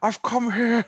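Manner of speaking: halting, bad documentary presenter impression; David Attenborough. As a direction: badly impersonate a wildlife presenter, pausing grandly. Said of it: "We are fucking shit at doing accents".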